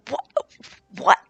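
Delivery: rising, disbelieving cry of joy; an anime fan hearing great news; stunned excitement. Retold or imitated. Anime fan reacting to a surprise new season, excited disbelief.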